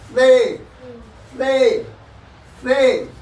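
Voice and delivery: "से" with tongue tip between the teeth; lisped se. Shown as a demonstration. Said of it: "जीभ को हल्का सा निकाल करके दांत पर रखेंगे". ("से" जीभ दांतों के बीच रखकर)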